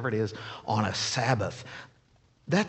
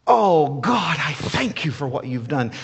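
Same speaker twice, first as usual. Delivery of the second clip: a touch of crying joy, louder; lightly voiced grateful woman praising God; joyful praise; 2 semitones higher, 5 dB louder than usual. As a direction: cry out thanks like the healed woman. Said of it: "repeatedly praising God".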